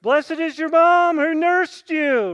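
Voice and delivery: high, loud, slow called-out heckle; woman in the crowd heckles Jesus; cheeky heckle. Retold or imitated. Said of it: "This woman says".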